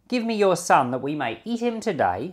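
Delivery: normal talking voice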